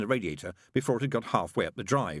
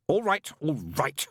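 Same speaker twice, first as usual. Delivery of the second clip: snapped; 7 semitones higher, 4 dB louder, slower than usual. A narrator's reading. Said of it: "Crowley snapped".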